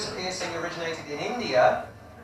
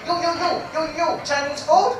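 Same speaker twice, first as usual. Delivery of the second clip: put-on menu girl calling; 9 semitones higher, 4 dB louder than usual. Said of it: "She's like".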